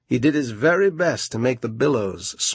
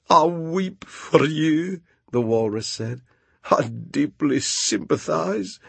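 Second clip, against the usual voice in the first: crocodile tears; 5 semitones higher than usual. Acted. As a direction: pretend to weep.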